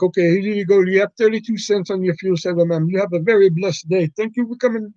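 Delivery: put-on lilting Indian-accented cashier patter; caricatured Indian accent. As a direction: send the customer off in a mock Indian accent. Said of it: "generic Indian character".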